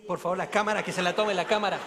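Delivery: loudly mimicking the priest's playful demand; mock loud plea. Retelling the priest to the congregation, loud playful plea. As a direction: call out for the camera, laughing. Priest begs for bunny smile.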